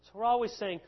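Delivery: normal talking voice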